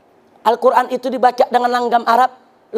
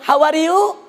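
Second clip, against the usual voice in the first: English with a higher Sundanese lilt; mock West Javanese intonation, pitched up; Sundanese-accented English; 4 semitones higher, slower than usual. Preacher imitating West Javanese speaking English, mock Sundanese accent. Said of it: "orang Jawa Barat enggak mau kalah".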